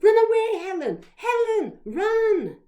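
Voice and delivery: urgent high yell; very loud, high, urgent calls; shouting. A narrator's reading. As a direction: scream a warning to a friend in danger. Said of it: "I shouted to Helen".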